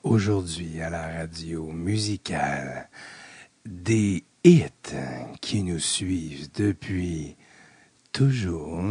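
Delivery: low, slow raspy radio host voice; groggy morning announcer voice with vocal fry. Pretend. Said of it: "c'est ma voix du matin".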